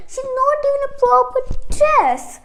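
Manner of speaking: high, snotty stepsister voice; whiny high-pitched sister. Acted. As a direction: sneer it as the mean sister. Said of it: "So the sister said".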